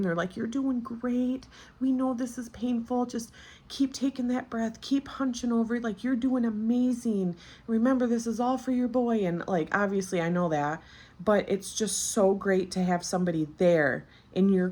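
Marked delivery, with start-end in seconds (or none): 0.3-9.3 high-pitched imitation of soothing staff; comforting encouragement. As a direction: imitate nurses coaxing a patient through pain. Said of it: "They were comforting the whole time".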